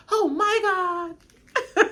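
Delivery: high, slow, teary oh my God; overwhelmed relief. Real, not acted. Relieved about the car, slow high teary cry. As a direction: stretch it out near tears.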